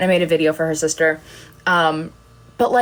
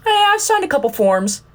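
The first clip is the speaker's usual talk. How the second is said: mock defensive; 9 semitones higher than usual. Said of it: "retold reply".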